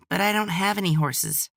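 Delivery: put-on high, bright little boy; an excited kid asking a question; eager child voice. A narrator's reading. Child character voiced by narrator.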